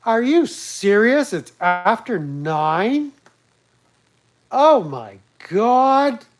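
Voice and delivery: high, slow, flamboyant mock-outraged narrator; Randall impression. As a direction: camp it up like the Honey Badger narrator, drawn out. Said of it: "Honey Badger narrates Randall impression".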